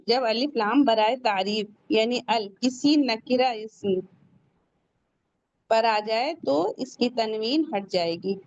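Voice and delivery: ordinary talk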